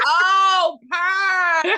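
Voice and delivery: loud, high "Oh, Paris"; a loud mock realization; mock surprise. Teasing friend about strangers finally getting her name. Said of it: "They be like".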